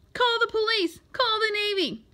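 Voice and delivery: high, panicky calls for help; shrieking. A narrator's reading. Coach alarmed by the tadpole, shrieking. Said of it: "shrieked the coach".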